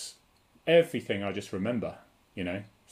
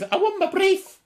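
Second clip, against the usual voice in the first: squeaky-high, louder Scouse caricature; mock Scouse accent; 5 dB louder than usual. Imitating what Scousers usually said, high mock Scouse accent. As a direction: send the Scouse right up high. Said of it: "why they talk so high".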